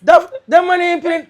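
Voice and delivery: ordinary talk, nothing marked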